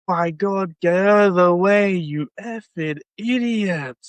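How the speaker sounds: imitated angry radio outburst; Oscar Piastri. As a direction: act out a driver's slow radio complaint. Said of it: "came over the radio and went".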